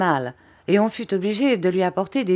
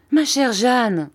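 startled exclamation; 6 semitones higher, 5 dB louder, slower than usual. A narrator's reading. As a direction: exclaim it, higher and louder. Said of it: "s'écria ma mère". (exclamation alarmée)

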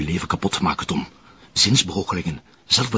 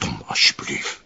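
pleading whisper; 9 semitones lower than usual. Acted. Father pleading with troubled son.

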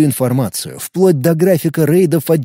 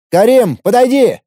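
higher, louder shout across the room; calling out; 9 semitones higher, 4 dB louder than usual. A narrator's reading. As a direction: call him over sharply. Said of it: "выкрикнул парень".

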